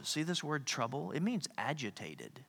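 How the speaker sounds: ordinary talk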